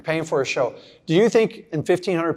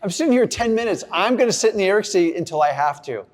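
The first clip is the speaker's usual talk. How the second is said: higher and louder, hammed-up protest about the heat; mock-indignant complaint; 7 semitones higher, 3 dB louder than usual. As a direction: whine about the heat half-jokingly. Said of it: "I go".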